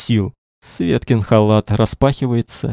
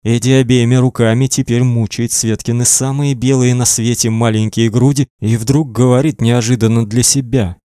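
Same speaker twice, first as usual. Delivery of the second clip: ordinary delivery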